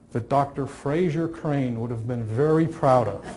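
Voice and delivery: normal talking voice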